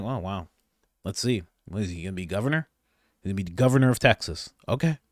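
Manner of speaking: low, soft muttered reaction; keeping quiet. Acted. Brother privately reacting to his brother running for office, keeping quiet. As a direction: keep it down, an understated private remark. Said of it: "I'm just gonna keep quiet".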